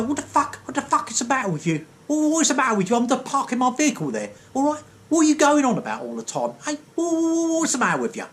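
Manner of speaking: high, indignant mock rant; offended bloke yelling at a gentle horn toot, pitch way up; mock angry tradie. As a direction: rant back at the neighbour tooting. Angry parker retold abusing teller.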